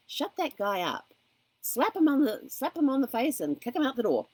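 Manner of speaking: firm, hammed-up banishing; mock forceful dismissal. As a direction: lightly play at throwing out a pest. Acting out banishing the inner critic.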